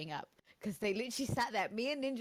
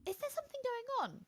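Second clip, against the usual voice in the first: high-pitched puzzled question; mock innocent inquiry, pitched up; curious bystander. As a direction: ask it high, like a clueless passer-by. Acting out puzzled man on train.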